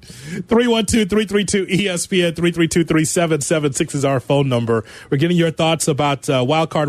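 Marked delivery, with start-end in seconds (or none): none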